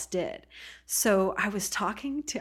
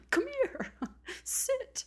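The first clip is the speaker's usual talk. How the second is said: tiny, very high, coaxing voice; luring rock voice; 3 dB quieter, slower than usual. Retold or imitated. Magnet Rock tempting a tired hiker, high coaxing voice. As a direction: beckon playfully in a high squeal.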